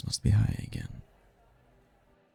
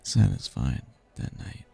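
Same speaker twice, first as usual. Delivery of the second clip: soft, low, lingering on each phrase; sultry reminiscence; 4 semitones lower, 8 dB quieter than usual. Acted. Demon savoring a memory of tempted humans, hushed and sultry.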